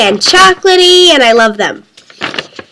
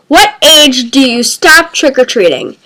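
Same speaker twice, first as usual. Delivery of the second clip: ordinary delivery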